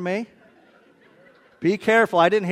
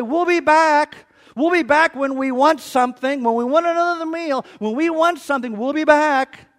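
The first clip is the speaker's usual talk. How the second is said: mock promise; 7 semitones higher, 6 dB louder than usual. Mock customer promising return.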